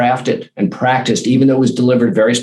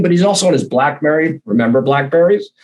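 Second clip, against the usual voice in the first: ordinary talk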